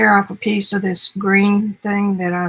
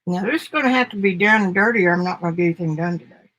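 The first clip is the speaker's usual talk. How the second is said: rushed; faster than usual. Real, not acted. Rushing through the work.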